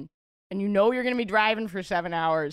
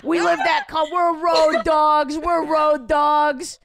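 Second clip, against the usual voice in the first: louder and higher, boasting road dogs; hammed-up shouted boast; 6 semitones higher, 5 dB louder than usual. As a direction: chant it like a proud road-warrior anthem. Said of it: "We're like".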